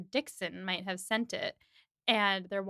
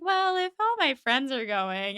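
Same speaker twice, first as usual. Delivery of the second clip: whiny; 4 semitones higher, 5 dB louder than usual. Retold or imitated. Emma whines to Knightley.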